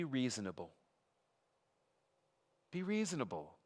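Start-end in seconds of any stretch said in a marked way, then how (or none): 2.7-3.5 higher coaxing repeat. Acted. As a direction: say "Be reasonable." again pitched higher, pleading. Preacher voicing the envoy's demand.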